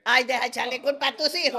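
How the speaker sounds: loud, fast, playful scolding; loud teasing protest. Real, not acted.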